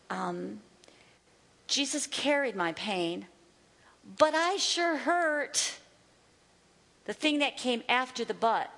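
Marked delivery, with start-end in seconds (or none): 1.7-5.8 negative confession. Shown as a demonstration. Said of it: "I'm gonna say it in the negative first".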